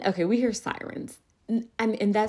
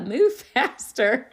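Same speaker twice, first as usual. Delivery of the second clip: laughing through it; 7 semitones higher, 5 dB louder, much slower than usual. Retold or imitated. Retelling own command, laughing.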